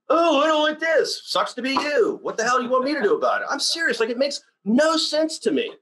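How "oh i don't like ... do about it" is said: high, scornful mimic of a whiner, brushed off; scornful mockery. Mocking complainers to listeners, high scornful whine. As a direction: sneer at the complainers.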